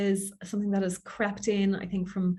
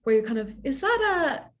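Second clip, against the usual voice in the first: upward inflection; 6 semitones higher than usual. Shown as a demonstration. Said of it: "upward inflection at the end of the sentence".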